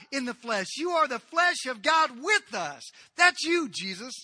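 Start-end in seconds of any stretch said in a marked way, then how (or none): none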